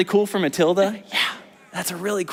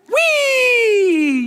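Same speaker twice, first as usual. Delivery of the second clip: very high, louder whee; gleeful whee; 4 dB louder than usual. Acted. Teacher voices the lift from the Ferris wheel, very high whee. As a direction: squeal with delight.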